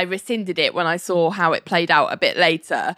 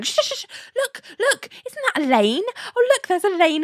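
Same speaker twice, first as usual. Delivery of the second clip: high-pitched urgent shush, then excited spotting; 12 semitones higher than usual. Retold or imitated. Ali cuts off Larry's arguing on spotting Elaine, urgent shushing. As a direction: imitate someone hushing a friend mid-argument. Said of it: "stops Larry from arguing with her by going".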